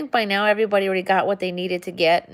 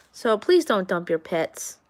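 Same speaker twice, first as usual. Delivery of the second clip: ordinary talk, nothing marked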